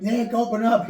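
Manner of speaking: loud high call at a locked door; calling through a door. Retold or imitated. Friends banging on the diner door at dawn, calling loudly. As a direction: yell to be let in at four in the morning.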